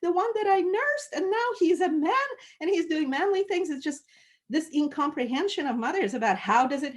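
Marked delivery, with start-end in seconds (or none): 0.0-3.7 put-on higher, wistful mother remembering her baby; tender mother's lament. Countess musing on her wounded son, tender and higher. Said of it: "she goes into that long meditation".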